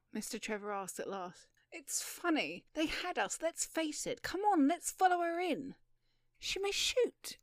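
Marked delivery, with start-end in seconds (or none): 1.7-5.8 high, animated voice; rising to a loud, high call; amused eagerness. Acted. Laughing man urges friend on. 6.4-7.4 very high, worried warning; high-pitched caution; anxious caution. Acted. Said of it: "Mister Trevor cautioned".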